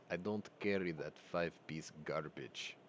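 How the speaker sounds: quiet impression of a Russian-accented speaker. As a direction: imitate a Russian dealer's accent, low and quiet. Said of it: "let me try to do it in his accent".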